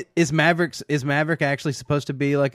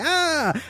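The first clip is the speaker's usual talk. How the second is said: high-pitched acted yelp; imitated yelp of a man being shot; quick high "ah" of mock pain; mock cry of pain. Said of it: "and he's like".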